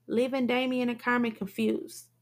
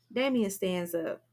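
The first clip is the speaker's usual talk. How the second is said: usual speaking voice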